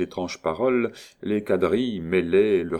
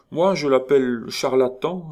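haughty countess; 7 semitones higher, 5 dB louder than usual. Acted. Countess mocking at the ball.

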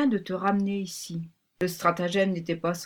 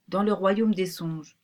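usual speaking voice

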